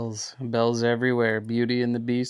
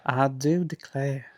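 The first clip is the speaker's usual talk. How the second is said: put-on 1 Southern belle accent; 6 dB quieter than usual. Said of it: "your take on that accent".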